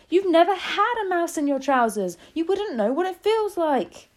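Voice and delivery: high, loud indignant protest; shouting back. A narrator's reading. Cook defending himself to mocking staff, shouting. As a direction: argue back at full, high volume. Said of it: "the man was shouting back".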